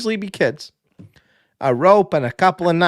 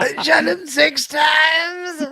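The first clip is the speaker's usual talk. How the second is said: very slow, high anguished wail; crying shout; much slower than usual. Retold or imitated. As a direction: wail it in despair. Doctor catchphrase, cried out.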